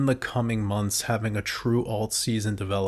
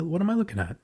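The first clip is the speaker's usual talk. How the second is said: high, stunned disbelief; can't believe what you see; shocked; 8 semitones higher, faster than usual. Real, not acted. Stunned at a huge chart move.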